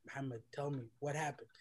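soft, low motherly coaxing; gentle pleading. Retold or imitated. Mom asks her son to tell her, gently. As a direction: press very quietly and tenderly.

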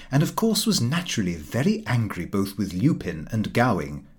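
ordinary delivery

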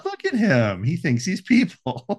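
high-pitched fond gushing; mock cooing. Co-host gushing over cute bear drawing, high cooing. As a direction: coo over it as if at a pet.

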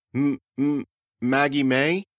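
put-on stammering girl. The girl naming Amanda's dog, stammering. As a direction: stutter before the name.

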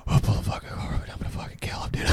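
imitated furious back-seat whisper; seething whisper. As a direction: whisper the order as the fuming friend. Seething friend threatens from back seat.

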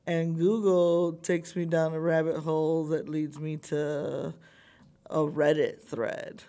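ordinary delivery

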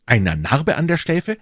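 astonished echo of what was just heard; startled exclamation. A narrator's reading. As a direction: echo it as a sudden, rising question. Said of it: "rief D'Artagnan".

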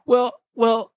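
high mock kid voice stalling; mock salesman stall. The salesman hedging at the door, mocked high.

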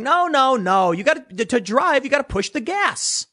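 high sneering caricature of a leftist; mock leftist voice. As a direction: parody a know-it-all leftist insisting on the gas. Mocking leftists urging the gas.

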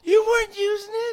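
high, loud squeaky cat voice; defensive cat. Acted. Cat answering the man it bit, defensive squeak. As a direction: squeak back in self-defence.

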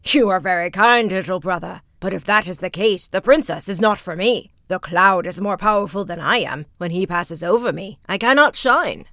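loud, hearty and good-natured; booming cheerful sun voice; jolly sun. Acted. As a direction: speak like a big jolly sun. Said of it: "the jolly round sun".

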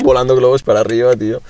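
usual speaking voice

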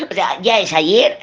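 chuckling through the words; laughing. Real, not acted. Reader laughing at her own point about yesterday, chuckling.

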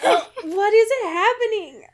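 high, loud, laughing confusion; cracking up in disbelief. Retold or imitated. Baffled reaction to the book.

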